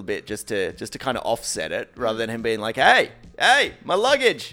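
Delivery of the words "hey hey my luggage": loud, high indignant shout; hammed-up angry luggage owner; mock outrage. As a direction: yell in mock protest at a stranger. Imagined angry suitcase owner shouting.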